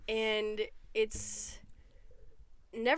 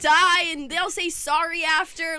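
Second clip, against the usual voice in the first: put-on old man swearing; 5 semitones higher, 5 dB louder than usual. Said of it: "and he's just swearing".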